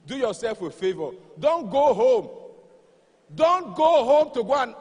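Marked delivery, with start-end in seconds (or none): 1.4-2.3 shouting. Acted. Comedian shouting advice to husbands. 3.4-4.7 shouting. Acted. Comedian shouting advice, building.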